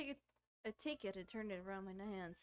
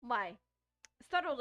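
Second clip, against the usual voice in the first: normal talking voice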